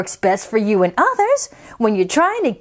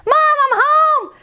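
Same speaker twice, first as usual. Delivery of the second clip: put-on much higher child calling; 12 semitones higher, 3 dB louder than usual. Isabella shouting to Mom, high child voice. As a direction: call it out across the house. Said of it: "yelling coming home".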